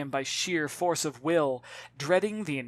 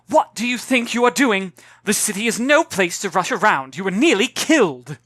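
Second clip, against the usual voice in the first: loud, higher, shaken scolding; 5 semitones higher, 9 dB louder than usual. Acted. Mother scolding her daughter after the tram, loud and upset. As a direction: tell her off, still frightened.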